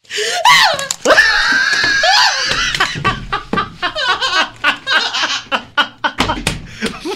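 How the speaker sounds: long high-pitched hammed-up wail; over-the-top fake crying fit; mock sobbing. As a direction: bawl theatrically, very high. Improvised breakdown ending the scene.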